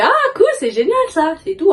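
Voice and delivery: high, delighted mock gush; retold excited reply. Retelling her reaction to the Nike contact, thrilled and high. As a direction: replay your own thrilled reaction to the offer.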